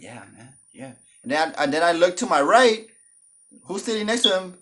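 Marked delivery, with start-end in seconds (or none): 0.0-0.9 low, soft, laid-back voice; playing it cool. Cousin introducing his girlfriend, hushed and cool. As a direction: quietly play it off. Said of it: "he's trying to play it cool".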